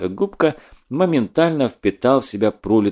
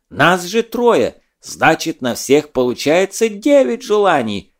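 loud, higher ranting protest; raging; 6 semitones higher, 3 dB louder than usual. Acted. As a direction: rage at the injustice. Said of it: "продолжал он бесноваться".

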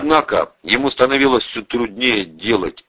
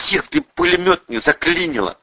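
higher-pitched understated moan; groaning; 7 semitones higher than usual. A narrator's reading. Andrei at the embrasure, groaning. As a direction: let it out as a slight groan. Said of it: "простонал Андрей".

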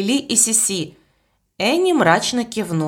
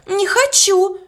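much higher and a bit louder, near a wail; panicked cry; 12 semitones higher, 3 dB louder, slower than usual. A narrator's reading. Young maid repeats her refusal, climbing into a panicked cry. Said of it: "плакала и дрожала".

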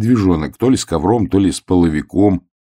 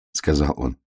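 ordinary delivery, nothing marked

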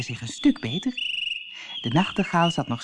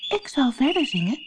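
high, light little bird voice; nightingale's voice; 10 semitones higher than usual. Acted. Nightingale bargains with Death in a high voice. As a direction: sing out as a small bird. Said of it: "zei de nachtegaal".

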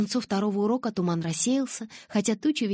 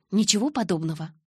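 ordinary delivery

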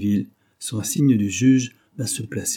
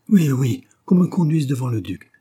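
higher, louder, vehement demand; vehement cry; 5 semitones higher, 7 dB louder than usual. A narrator's reading. Young woman demanding to face the duke, vehement. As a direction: burst out insisting on it. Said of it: "s'écria Charles Desbleuze d'un ton véhément".

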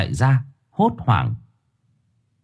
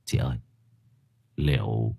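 low, soft impatient question; anxious; 8 semitones lower, 5 dB quieter than usual. A narrator's reading. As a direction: press her quietly, worried. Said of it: "thằng Tưởng sốt ruột".

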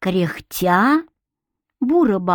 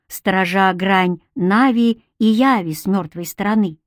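usual speaking voice

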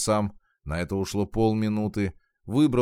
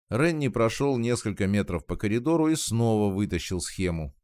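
ordinary delivery, nothing marked

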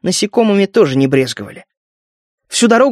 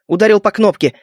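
ordinary delivery

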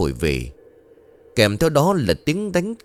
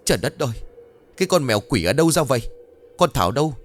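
higher-pitched, understated shriek; mock shrieking; 7 semitones higher, faster than usual. A narrator's reading. Scratched stepmother yells at the girl for a towel, mildly shrieking. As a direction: screech in alarm without much volume. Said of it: "Cô ta vừa hét vừa chu chéo lên".

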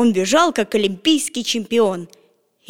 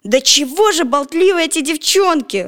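annoyed; 6 semitones higher, 4 dB louder than usual. A narrator's reading. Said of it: "рассердился Петя".

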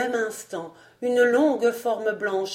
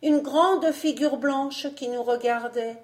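put-on child's voice; 5 semitones higher than usual. A narrator's reading. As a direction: voice a child, pitched well up. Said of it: "dit l'enfant".

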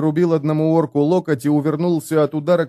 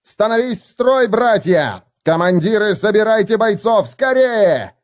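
shouting orders; 9 semitones higher than usual. Acted. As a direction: bellow orders across the hall. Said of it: "заорал он".

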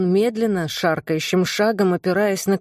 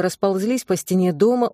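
plain reading voice

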